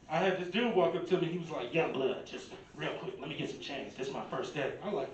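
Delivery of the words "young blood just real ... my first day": low, rough panhandler voice, trailing off quietly; homeless man's straggly voice. Retold or imitated. Homeless man asking the comedian for change, in a low quiet rasp. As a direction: beg for change in a worn-out old voice. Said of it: "they give you that voice first day too".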